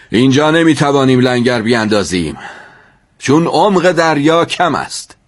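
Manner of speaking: commanding call to the passengers; captain's announcement. A narrator's reading. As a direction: announce it to the whole deck. Captain addresses passengers on deck.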